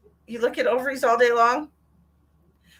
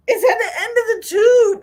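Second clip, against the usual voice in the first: high, loud, laughing through the words; laughing disbelief; 12 semitones higher, 9 dB louder than usual. Real, not acted. Mocking the pathology report wording, laughing in disbelief.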